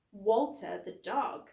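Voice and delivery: usual speaking voice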